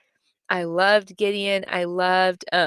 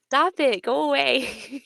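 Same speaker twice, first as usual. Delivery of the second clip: higher, playfully shooing her off; mock annoyed; 5 semitones higher than usual. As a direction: tease her friend away, mock exasperated. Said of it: "And I was like".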